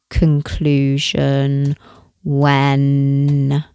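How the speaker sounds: "that" rising, "conclusion" dragged very slowly; slow drawn-out. Acted. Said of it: "I'm gonna change that".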